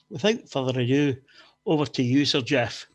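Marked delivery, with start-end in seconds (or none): none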